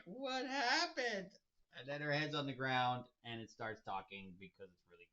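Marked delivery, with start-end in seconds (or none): 0.0-1.3 high, slow mock wail; slow, high mock confusion; baffled onlookers. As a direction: ask it bewildered and whiny. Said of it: "everybody's like".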